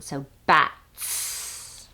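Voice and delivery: "bats" with the final "ts" hissed long; stretched final ts. Shown as a demonstration. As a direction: draw out the air at the end of "bats". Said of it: "extending it to create that s sound".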